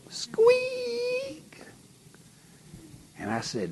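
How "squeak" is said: squeaky skin sound. Retold or imitated. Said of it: "and it'd go squeak".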